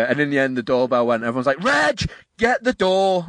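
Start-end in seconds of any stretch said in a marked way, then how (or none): 1.6-3.3 high, drawn-out call. Retold or imitated. Coworkers shouting to Reg about the doorbell, imitated calling out. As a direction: holler for a workmate to get the door.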